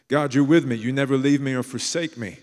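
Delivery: loud, lifted declaration to God; bold proclamation. Acted. Preacher voicing a believer declaring God's promises, loud and bold. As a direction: proclaim it boldly, like a claim of faith.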